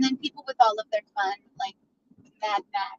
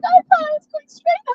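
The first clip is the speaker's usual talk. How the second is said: mock dismay; 5 dB louder than usual. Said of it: "your whole confidence".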